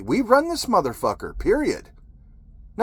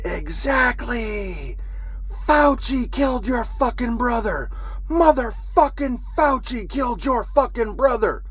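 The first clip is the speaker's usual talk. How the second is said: replaying a rant at strangers, pitch raised; a retold shout, pitched up; retold screaming; 8 semitones higher than usual. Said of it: "I fucking started screaming".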